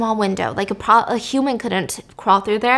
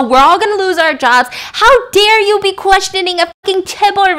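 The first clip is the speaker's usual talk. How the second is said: much higher and louder, yelling down the phone; furious boss yelling; 9 semitones higher, 10 dB louder than usual. Retold or imitated. Boss on the phone tearing into the captain, yelling furiously. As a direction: bellow at him like an enraged boss who fears for his job. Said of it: "the boss is not being nice".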